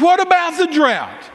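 high, stretched-out retort; re-enacted angry yell, slow and high; indignant yell. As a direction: fire it back in exasperation, re-enacting the row. Retold angry quarrel with preachers.

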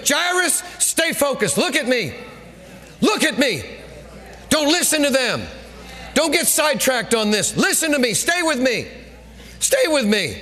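high-pitched, insistent command; acted intense pleading to stay with you; focused urging. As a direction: urgently hold someone's attention, phrase by phrase. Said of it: "he got real focused".

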